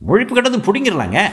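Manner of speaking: high, loud eager threat; eager brute. A narrator's reading. Henchman urging the boss to rough her up, high and loud. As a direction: threaten with nasty glee.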